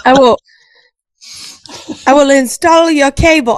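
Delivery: hammy mock-Transylvanian cable guy; attempted Transylvanian accent. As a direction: try a bad Dracula-style Transylvanian accent. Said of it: "I can't even talk like that".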